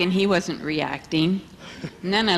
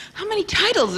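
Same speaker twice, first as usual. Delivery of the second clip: high questioning; 13 semitones higher than usual. Retold or imitated. Swami questions again, retold.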